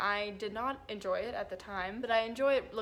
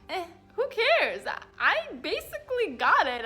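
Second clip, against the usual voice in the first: higher, louder, careless shrug in the voice; shrugging dismissal; 8 semitones higher, 8 dB louder than usual. Real, not acted. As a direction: brush it off with a laugh in the voice.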